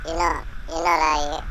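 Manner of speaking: ordinary talk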